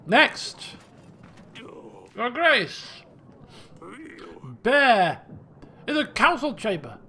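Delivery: urgent shouted alarm; calling out. Acted. Servant alerting the king to a bear in the chamber, calling out. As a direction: cry out short, urgent announcements.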